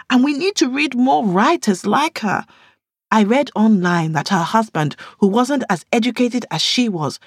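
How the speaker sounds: earnest reader defending her author; Yemisi's passionate voice. Acted. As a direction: press the point hard.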